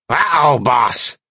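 slow, croaky demon voice; raspy imp alarm. A narrator's reading. Imp charged by a skunk warns boss, raspy. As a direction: draw out a raspy warning. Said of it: "croaked in its raspy, high-pitched voice".

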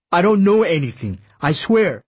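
pleading denial. Acted. Intern under suspicion swears ignorance to his accusers, pleading. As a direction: protest innocence with rising urgency.

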